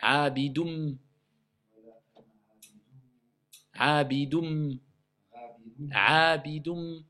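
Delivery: "Abidum" slowly, each time ending on a held m; "Abidum" with a lengthened nasal close; assimilated nun. Shown as a demonstration. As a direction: repeat "Abidum" as a drill, humming the final m.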